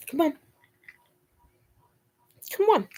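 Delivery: high coaxing call; lilting come-on to the dog; coaxing pet voice. Real, not acted.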